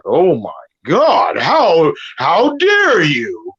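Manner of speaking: slow, drawn-out fake gasp of offense; mock outrage. Caller mocking the host's outrage, slow and drawn out. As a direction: stretch it out like a clutching-pearls host. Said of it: "he's like".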